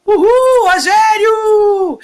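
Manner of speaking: high, loud whoop of a name; excited cheer. Real, not acted. Singer spots a viewer she knows, cheering loud and high. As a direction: shout the name out with joy.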